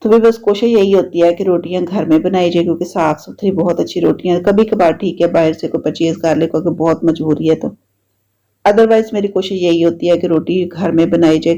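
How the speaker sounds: normal talking voice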